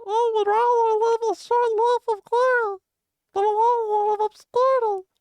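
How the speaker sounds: very high squeaky little creature voice; Jinjo voice. Pretend. Chat asks for the quote in Jinjo voice, very high squeaky creature. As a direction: squeak it out like a tiny video game critter. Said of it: "Alexander the Great quotes to the Jinjo voice?".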